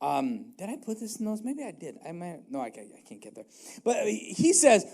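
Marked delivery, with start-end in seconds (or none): none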